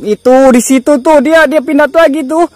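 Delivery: loud, high-pitched panicked pointing-out; alarmed shout. Real, not acted. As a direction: shout it in alarm to your viewers. Spots the ghost moving, alarmed.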